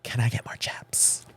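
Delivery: whispered request to the waiter; sheepish whisper. Retold or imitated. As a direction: hush it like you're embarrassed to ask.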